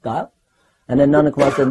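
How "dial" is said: "Dial" as a short rising question; softer one-word query, DIAL gliding up; imitated puzzled "Dial?", rising at the end; Prabhupada's questioning. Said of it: "You know how Prabhupada would question".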